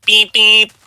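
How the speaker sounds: mock car horn honk; bus horn beep. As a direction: imitate a giant horn from the sky. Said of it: "booms a giant celestial bus horn".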